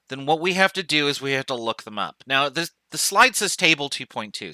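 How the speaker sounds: usual speaking voice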